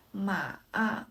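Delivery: "ma, a" dipping down, then turning up; third tone. Shown as a demonstration. Teacher shows the turned tone, "ma" falling then rising. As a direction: drop "ma" and curve it back up. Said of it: "先降后升".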